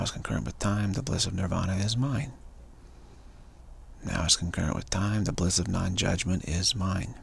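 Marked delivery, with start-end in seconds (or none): none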